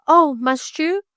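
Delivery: high, tearful voice close to a sob; a girl fighting back a sob; near sob. A narrator's reading. Girl answering her guardian, voice catching on a sob. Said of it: "in a voice which sounded like a sob".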